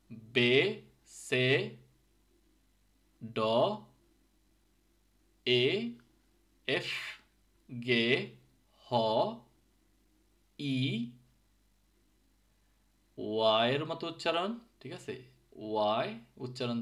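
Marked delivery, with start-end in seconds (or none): 0.1-11.1 each letter said alone and clearly: বে, সে, এ, গে, ই; Finnish alphabet sounds. Shown as a demonstration. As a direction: spell out the Finnish letters one by one. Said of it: "So just listen, carefully".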